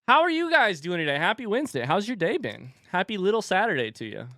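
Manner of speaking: high, cheery hello to chat; chirpy streamer greeting; bright greeting. Real, not acted. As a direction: sing out the welcome to chat.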